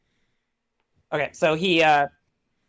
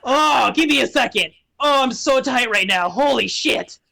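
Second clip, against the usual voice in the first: higher-pitched, strained little imp voice; imp stretching; 4 semitones higher than usual. Acted. Imp stiff from the box, strained exclaiming. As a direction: complain while stretching out stiff limbs. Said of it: "stands up out of his box".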